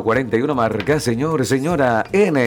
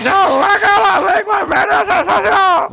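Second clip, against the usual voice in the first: put-on high, loud cackling old man shout; 4 dB louder than usual. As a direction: yell like a rowdy grandpa, much higher. Voices the grandpa number jokingly.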